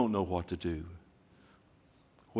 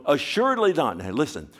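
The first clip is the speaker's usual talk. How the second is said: ordinary talk, nothing marked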